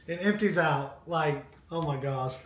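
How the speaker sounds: ordinary talk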